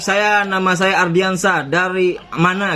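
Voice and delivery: slow, courteous mock-polite intro; polite introduction. Shown as a demonstration. Showing the debt collector how to introduce himself, slow and polite. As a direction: spell out how a decent caller speaks, slowly. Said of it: "Ngomong dong baik-baik".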